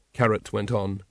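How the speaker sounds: plain reading voice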